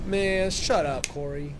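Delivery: loud, slow, fed-up shout; exasperated outburst. Real, not acted. Reacting to a couple's texts, loud exasperated yell. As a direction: snap at the screen in disbelief.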